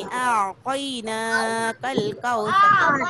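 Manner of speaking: आतैना with a softened ain, very slow; drawn-out demo of आतैना कल कौसर with a gentle ain; teacher modelling soft ain pronunciation. Shown as a demonstration. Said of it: "नरमी करनी।".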